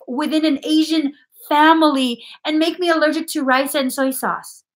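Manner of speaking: high, loud frustrated rant; venting, pitch way up and loud; outburst. Real, not acted. Psychic ranting to client about food allergies, outraged. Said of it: "I'm sorry for my outburst".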